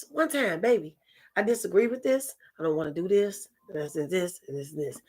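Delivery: ordinary delivery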